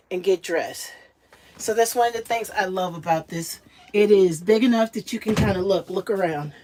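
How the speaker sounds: ordinary talk, nothing marked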